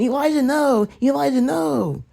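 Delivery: high shrieking mock cry of Lavelle; mock panic. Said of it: "Lavelle's fat ass wants to jump in".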